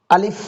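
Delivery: আলিফ said clearly ending in f, "alif"; correct alif with f. Shown as a demonstration. Teacher showing students the right way, alif. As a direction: end আলিফ on a crisp f. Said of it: "ফ দিয়ে বলতে হবে".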